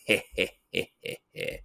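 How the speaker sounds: low sly demon laugh fading to a breathy whisper; demon chuckle. Retold or imitated. The bridge demon mocks Usagi's offer, low sly snicker. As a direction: give a creepy villain snicker that dies away. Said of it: "going like".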